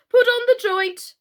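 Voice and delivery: high, loud imperious queen; Red Queen. A narrator's reading. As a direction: bark it out like a haughty queen. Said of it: "she said".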